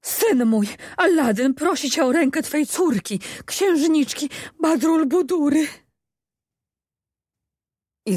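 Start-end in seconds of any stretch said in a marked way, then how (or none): 0.0-5.7 high, loud terrified cry; frightened shriek. A narrator's reading. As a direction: blurt it out in panic. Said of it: "wrzasnęła przerażona własnymi słowami Maruda". (przerażony, wysoki wrzask)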